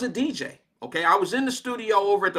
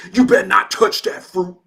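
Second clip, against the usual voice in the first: mock tough-guy threat; 7 dB louder than usual. Turbo's warning repeated, louder.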